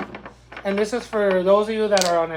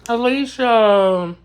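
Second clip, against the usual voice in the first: drawn-out call; 3 semitones higher, 8 dB louder, much slower than usual. Real, not acted. Calling out a name.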